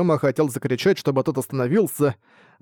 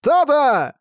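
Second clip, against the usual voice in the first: high, loud cry of alarm; shouting a name; 12 semitones higher, 4 dB louder than usual. A narrator's reading. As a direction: scream his friend's name. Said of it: "закричал Синта Айда".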